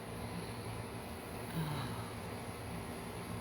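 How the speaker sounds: a long, breathy, sympathetic sigh; long heavy sigh. Real, not acted. Host sighs on hearing the guest was abandoned, heavy sigh.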